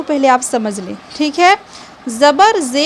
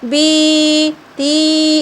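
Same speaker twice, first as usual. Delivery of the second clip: "बी ती" with both long ee vowels dragged right out; stretched wrong reading; much slower than usual. Shown as a demonstration. Teacher showing pupils how not to read zer, vowels dragged out. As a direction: hold "बी" and "ती" far too long, very slowly. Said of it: "इस तरीके से खींच कर नहीं पढ़ना है".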